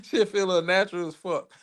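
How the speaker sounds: words breaking into laughter; laughing. Real, not acted. Streamer to chat, laughing about the phone stream. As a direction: laugh it out, amused at himself.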